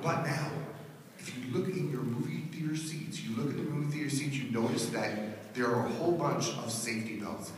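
low, soft, calm hypnotist talk; soothing hypnotist voice. Acted. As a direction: lull them into feeling safe before the scare. Hypnotist's soothing lead-in to scary movie.

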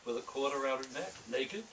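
a hint of a brooding noir narrator; noir monologue reading. Author reading his noir piece aloud, lightly brooding. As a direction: muse aloud like a hard-boiled confession.

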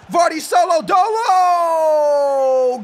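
long stretched high yell; hype shout. Real, not acted. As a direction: draw the words out like a stadium announcer. Hyped play-by-play of Vardy run.

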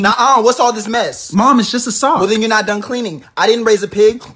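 loud, fed-up mom; mother loudly refusing to accept a sock as clean; scolding mom. Acted. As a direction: scold the kid over the mess. Mom scolding messy kid.

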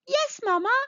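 high-pitched, eager little cry; excited little mole. A narrator's reading. Little mole answers mamma, crying out high and eager. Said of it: "cried Little Mole".

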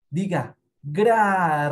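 gra said loud and crisp; loud model syllable. Shown as a demonstration. Teacher shows the class how gra sounds, loud. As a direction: belt out gra for the kids to copy. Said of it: "Repita el sonido".

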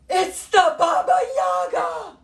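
high, loud alarmed shout; crowd shouting. Acted. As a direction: yell out the monster's name in alarm. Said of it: "Everyone said".

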